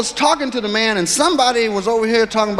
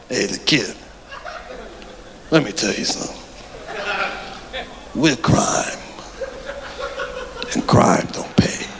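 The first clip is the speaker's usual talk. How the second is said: low, soft, rasping gangster warning; menacing mobster; 6 semitones lower, 6 dB quieter than usual. Retold or imitated. Mobster warning the kid, low and whispery. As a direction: lean in and whisper the threat.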